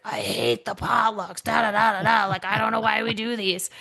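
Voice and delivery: lightly voiced grouchy older colleague; grumbling coworker. Coworker complaining at the office potluck, grumbling. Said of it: "with a plate in hand being like".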